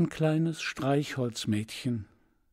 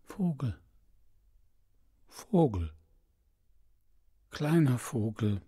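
weak, softer whispering plea; the prince barely breathes the words; faint whisper; 4 dB quieter than usual. Acted. Prinz flüstert schwach. (schwach geflüstert)

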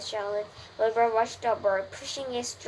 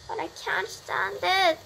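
higher pained cry; shouted; 9 semitones higher than usual. Acted. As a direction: cry it out in despair. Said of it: "shouted Wilbur".

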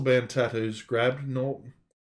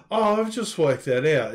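louder, higher realisation; sudden aha moment; 4 semitones higher, 6 dB louder than usual. Real, not acted. Reader catching the spoof, excited aha.

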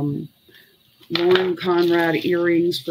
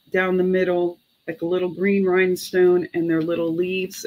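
normal talking voice

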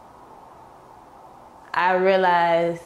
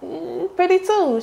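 high, sweet mock-girly voice for "thank you"; high put-on voice thanking the stranger; her younger self flattered; 12 semitones higher than usual. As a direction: play it up as a flattered young girl, much higher. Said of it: "I'm like".

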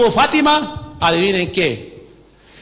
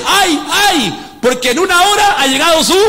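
lamenting cry; 8 semitones higher than usual. Retold or imitated. Pastor voicing the fallen city's mourners, wailing.

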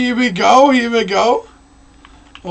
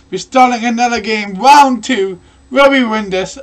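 usual speaking voice

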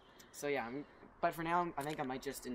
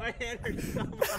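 laughing; slower than usual. Real, not acted. Trick shot mishap.

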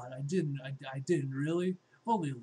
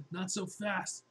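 usual speaking voice

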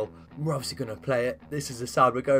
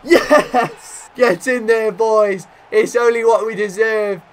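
high, loud joyful yells with a laugh; goal celebration; 8 semitones higher, 10 dB louder, slower than usual. Real, not acted.

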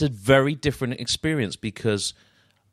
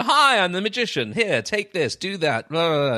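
pushy magician; 8 semitones higher than usual. Retold or imitated. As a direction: bounce in like an oblivious entertainer. Magician interrupting a sad conversation.

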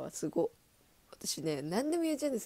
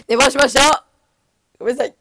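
much louder, higher, blurted apology; 7 semitones higher, 17 dB louder, faster than usual. Real, not acted. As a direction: shout out the confession. Loud apology for oversleeping. (大声で謝る)

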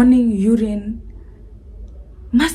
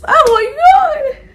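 laughing; 6 dB louder, much slower than usual. Real, not acted. Laughing in disbelief.

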